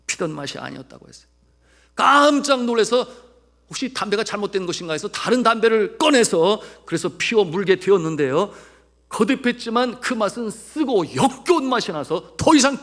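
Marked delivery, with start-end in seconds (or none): 1.9-12.8 raised, louder storytelling from the pulpit; forceful preaching. Real, not acted. Preacher telling of suddenly bitter cigarettes, forceful preaching. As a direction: dramatize the startling turn with force.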